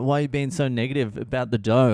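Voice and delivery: ordinary delivery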